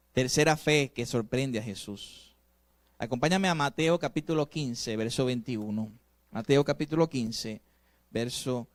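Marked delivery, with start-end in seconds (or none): none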